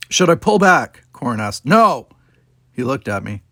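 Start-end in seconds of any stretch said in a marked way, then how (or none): 0.1-1.0 loud, high anxious ask; urgent question. A narrator's reading. Helmsman asking the captain urgently, loud anxious. 1.6-2.1 loud high snapped no; sharp no. A narrator's reading. Captain stopping the helmsman, sharp loud no.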